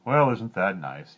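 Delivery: slow, bored, loud king; a king too bored to care; yawning king. A narrator's reading. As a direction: drag it out as if yawning. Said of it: "yawned the king".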